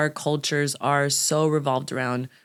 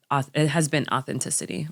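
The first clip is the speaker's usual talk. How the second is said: ordinary delivery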